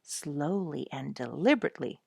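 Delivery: plain reading voice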